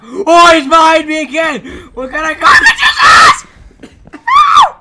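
frantic fast yelling; panicked shouting, rushing words; panicking gamer. Real, not acted. Chased by monster in game.

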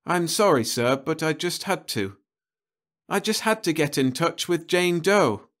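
put-on earnest young man. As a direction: stand firm but sorry. Roe explains himself.

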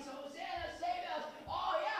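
high shouted cheer; crowd's cheer. Retold or imitated. Preacher voices the cheering crowd, high pitched shout. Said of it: "that are shouting".

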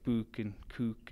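"book" and "cook" with long oo, drawn out; Newcastle vowels. Shown as a demonstration. Runner repeating what Londoners find funny, long oo in "book" and "cook". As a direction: slowly say "book" and "cook" with a Geordie oo. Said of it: "they think it's quite funny that we say".

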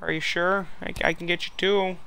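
high, polite put-on voice; eager-to-please neighbour. Neighbour offering the guys a biscuit, high polite voice.

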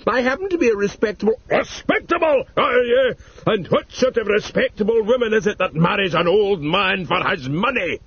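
mocking old husband. Husband mocks respectable, quarrel.

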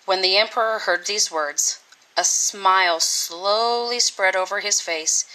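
plain reading voice

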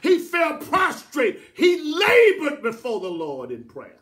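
high, loud, slow bursts of sermon; fervent preaching. Real, not acted. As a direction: drive each phrase out with full force.

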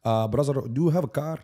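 pry like a scam caller; Indian scammer accent. Pretend. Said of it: "Indian scammer".

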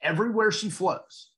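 ordinary delivery

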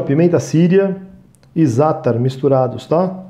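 ordinary talk, nothing marked

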